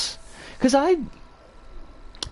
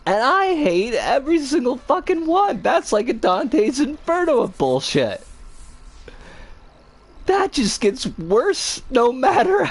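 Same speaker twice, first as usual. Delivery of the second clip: ordinary talk, nothing marked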